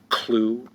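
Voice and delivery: "Clue" as a single rising syllable; one drawn syllable. Shown as a demonstration. Teacher showing one syllable, "Clue" said as one rising beat. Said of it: "Clue is one syllable".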